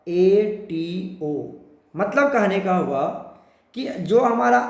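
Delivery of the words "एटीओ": एटीओ said very slowly, closing on o; ending pronounced. Shown as a demonstration. Teacher showing students the ate-to-ato ending. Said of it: "तो कैसा pronounce होगा?".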